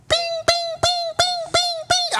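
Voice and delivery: very high chiming bings; mimicking notification pings; imitated alert chimes. Phone alerts going off.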